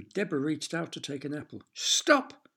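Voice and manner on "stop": sharp, high, loud cry; shouted stop. A narrator's reading. Derek warning Deborah off the apple, shouting. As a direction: yell to stop her in time. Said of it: "shouted Derek".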